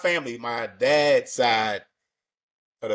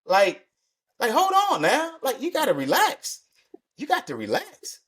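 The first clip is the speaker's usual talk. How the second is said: mock alarm; 14 semitones higher than usual. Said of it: "I'm like".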